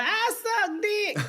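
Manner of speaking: high, slow hollered punchline; crude stage persona. Retold or imitated. Comic mocking her old jokes, high hollered punchline.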